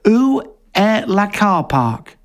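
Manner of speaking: hammed-up French phrase-book question; mock French. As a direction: sound each word out like a Brit abroad. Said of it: "Let me try".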